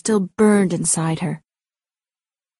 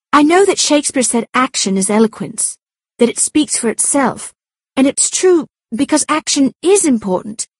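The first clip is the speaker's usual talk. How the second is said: excited; 5 semitones higher than usual. A narrator's reading. Abby excited about film.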